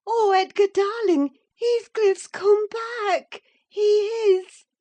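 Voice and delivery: very high and loud, slow ecstatic cry; overjoyed and breathless. A narrator's reading. Catherine tells Edgar that Heathcliff has returned, ecstatic. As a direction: cry the news out in rapture. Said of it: "she panted".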